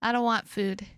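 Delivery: normal talking voice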